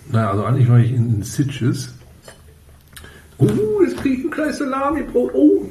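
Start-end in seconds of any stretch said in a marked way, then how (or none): 3.4-5.7 put-on very high squeaky child voice; childlike voice. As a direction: squeal it like a delighted little kid. Kid voice about salami sandwich.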